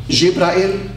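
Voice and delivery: "Jibrael" with a clear "EEL" ending; Semitic pronunciation. Shown as a demonstration. Showing listeners the El in names, "Jibrael" pronounced zhib-ra-EEL. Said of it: "all the Hebrew names end with El".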